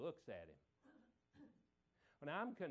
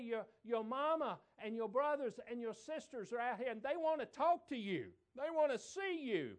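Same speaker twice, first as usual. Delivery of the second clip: high, loud preacher delivery; full-force preaching; 7 semitones higher, 7 dB louder than usual. Acted. Preacher telling the crowd about Jesus' family. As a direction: preach it with fire.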